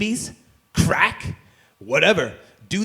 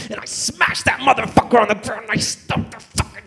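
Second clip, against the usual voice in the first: rising rage; 5 semitones higher, 4 dB louder than usual. Acted. Smashing the phone.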